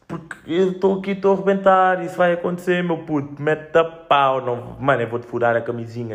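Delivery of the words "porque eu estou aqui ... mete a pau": high, loud and urgent; excited shouting. Pretend. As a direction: shout it like a ticking time bomb. Voicing the clock bursting.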